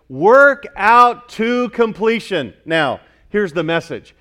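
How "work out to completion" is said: "Work out to completion" drawn out slowly and loud, leaning on work; solemn slow emphasis on "Work out to completion"; slow emphatic stress. Shown as a demonstration. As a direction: spell it out slowly for the church. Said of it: "watch this".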